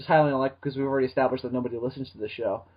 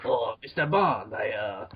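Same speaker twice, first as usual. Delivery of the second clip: slow, lightly voiced villain; hint of a suave villain purr; Bond villain; 7 semitones lower, 4 dB quieter, slower than usual. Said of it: "Sounds like a Bond villain".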